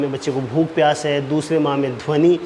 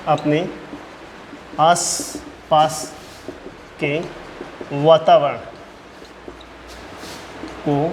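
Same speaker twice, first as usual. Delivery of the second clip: one word at a time, widely spaced; slow dictation; 5 dB quieter, slower than usual. Real, not acted. Teacher dictating the tenth-month point, word-by-word dictation. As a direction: dictate word by word for note-taking.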